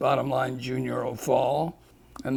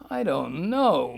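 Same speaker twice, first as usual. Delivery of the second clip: usual speaking voice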